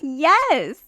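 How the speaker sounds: smug, high yes; a knowing, smug admission; smug sarcastic exposé. Retold or imitated.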